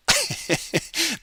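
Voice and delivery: a quick high laugh at himself; high-pitched laugh. Real, not acted. Laughing at his own swear to viewers, high laugh.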